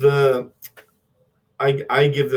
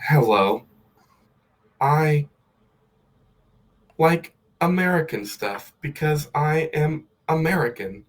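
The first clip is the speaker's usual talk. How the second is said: higher, hammed-up American accent; pitched-up fake American, badly done; 5 semitones higher than usual. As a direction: put on a clumsy cliché American voice. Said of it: "This is bad".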